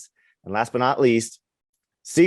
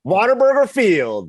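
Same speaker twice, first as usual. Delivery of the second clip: triumphant announcer call; 9 semitones higher, 4 dB louder than usual. Real, not acted. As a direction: belt out the ballpark name like an announcer. Announces the name after checking it.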